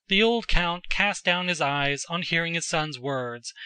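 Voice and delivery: usual speaking voice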